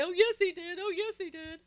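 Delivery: high, loud, sing-song taunt; mocking Jeff Flake. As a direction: taunt it like a tattling schoolkid, pitched high. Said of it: "come right out after him and say".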